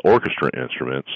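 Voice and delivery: normal talking voice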